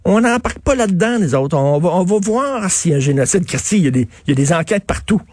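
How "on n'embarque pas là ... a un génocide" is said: mock Liberal cabinet. Host mocks Liberal cabinet dodging genocide question, high sneering whine. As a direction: pretend to stall, sneering. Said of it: "le cabinet du Parti libéral a dit".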